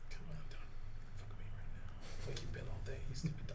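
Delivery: whispered plea to the dice; whispering. Real, not acted. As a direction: beg the dice under breath. Pleading with the dice.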